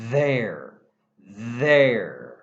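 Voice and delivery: "There" with a buzzing voiced TH, held on the tongue; slow model "There" with a voiced TH. Shown as a demonstration. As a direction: let the TH in "There" vibrate in the throat. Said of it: "If you say it properly, you can feel the vibrations".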